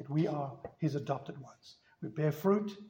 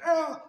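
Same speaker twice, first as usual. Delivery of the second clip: high laughing oh; 11 semitones higher than usual. Retold or imitated. Child's cry as teens flee.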